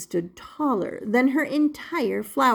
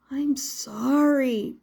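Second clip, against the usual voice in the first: apologetic; 3 semitones higher, much slower than usual. Acted. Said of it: "Punchinello apologized".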